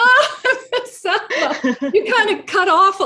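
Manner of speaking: high, laughing delight at the word. Real, not acted. Host laughing at the guest's slang, high and delighted. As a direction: laugh it out, tickled. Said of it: "host laughs at the slang".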